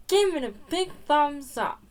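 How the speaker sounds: ordinary delivery, nothing marked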